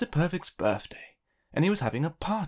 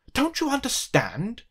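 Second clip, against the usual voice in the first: higher and slow, disbelieving; exasperated cry; 9 semitones higher, 3 dB louder than usual. A narrator's reading. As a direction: drag it out in frustration. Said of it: "cried Mister Uppity".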